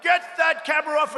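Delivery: high, loud imitation of a screamed command; mock screamed order. Imitating bosses screaming at cameramen, high and loud. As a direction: act out a boss yelling an order. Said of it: "They're screamed at".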